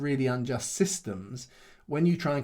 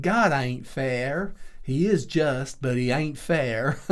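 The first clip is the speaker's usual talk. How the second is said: put-on slow, drawn-out Texan accent, a bit louder; 5 dB louder, much slower than usual. Quoting an American friend's saying to a listener, in a Texan accent. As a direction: drawl it like a plain-spoken Texan friend. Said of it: "do a Texan accent".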